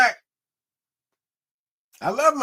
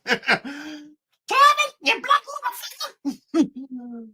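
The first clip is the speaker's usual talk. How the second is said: imitating mean old aunt; 8 semitones higher than usual. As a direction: bark it like the mean aunt yelling at her nephew. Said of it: "She would sound just like that".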